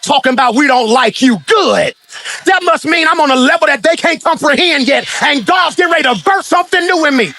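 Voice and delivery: high, driving preacher's cry; a climbing sermon shout; full-force preaching. Acted. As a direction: preach it out to the whole room.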